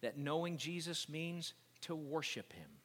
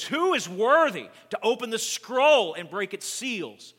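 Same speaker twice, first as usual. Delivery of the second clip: strong angel's ringing proclamation, high and loud; 8 semitones higher, 17 dB louder, slower than usual. A narrator's reading. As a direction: proclaim it at full volume to all. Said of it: "proclaiming with a loud voice".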